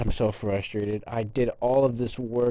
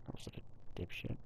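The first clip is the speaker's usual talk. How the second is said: whispered self-scolding under his breath; muttered to self; 17 dB quieter than usual. Real, not acted. Gamer scolding himself after a blunder, whispered mutter. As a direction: breathe it out, annoyed at yourself.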